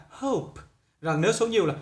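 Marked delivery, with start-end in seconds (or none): 0.1-0.6 soft, slow British hope; British accent. Shown as a demonstration. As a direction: quietly model the British hope. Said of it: "Giọng Anh là".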